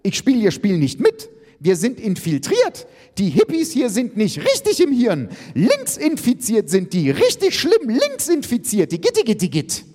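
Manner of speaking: high, hysterical shrill rant; screaming. A narrator's reading. Iris ranting about the hippies, screaming. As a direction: shriek on and on in outrage. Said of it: "schrie Iris".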